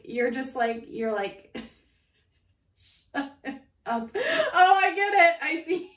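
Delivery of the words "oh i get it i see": much higher and louder, laughing; real laughter on oh I get it; laughing realization. Real, not acted. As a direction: crack up as it clicks.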